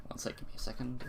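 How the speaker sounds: quick hushed whisper off-mic; whispered aside. Real, not acted. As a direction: murmur it to yourself. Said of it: "unvoiced whisper aside".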